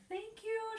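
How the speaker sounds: high, bright calling out; calling thanks. Acted. Girl calling thanks to the departing birds. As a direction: call after the flying birds. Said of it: "she called".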